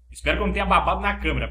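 usual speaking voice

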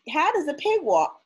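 usual speaking voice

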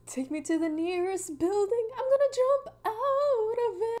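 high, whiny mock wail; mock despair. A teen wails in mock despair after an insult. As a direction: pretend to be crushed.

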